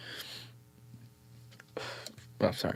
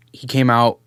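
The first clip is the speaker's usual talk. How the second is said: ordinary delivery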